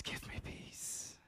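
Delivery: slow breathy whisper; hushed desperate whisper; whispered plea. Acted. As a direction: let the plea barely escape. Unvoiced plea of the suffering.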